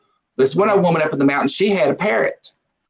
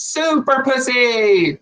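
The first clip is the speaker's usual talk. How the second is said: mock scream; 9 semitones higher, much slower than usual. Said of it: "and she screams".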